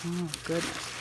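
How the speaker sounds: ordinary delivery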